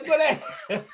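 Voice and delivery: hammed-up command to Davy; mock yell. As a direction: bark the order in jest, laughing. Acting out sending rebels away.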